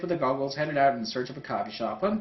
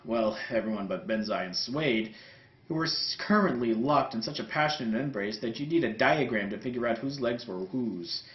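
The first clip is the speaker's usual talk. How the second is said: ordinary delivery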